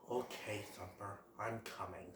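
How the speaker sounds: weary sigh, then low and very soft; the Great Prince. Acted. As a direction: give in reluctantly, deep and hushed. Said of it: "the Great Prince is all like".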